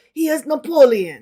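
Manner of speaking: just a hint of French accent on "He is Napoleon"; flavor of French accent. Shown as a demonstration. Reader demoing Napoleon, a light French accent. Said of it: "give the flavor of".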